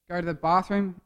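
ordinary talk

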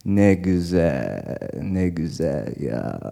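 low, slow, delighted father voice; father on the phone. Retold or imitated. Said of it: "He's talking on the telephone".

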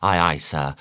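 plain reading voice

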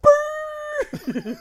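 high, yelping mock dog howl; mock beagle yelp. Comparing kid's cry to a beaten beagle, mock yelp. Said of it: "It's like a beagle getting beat".

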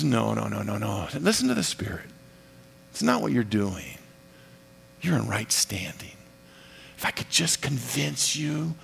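soft, low, hushed comfort; acted soothing voice, almost intimate; gentle reassurance. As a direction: hush them tenderly, voice low. Voices Jesus calming the protesting believer.

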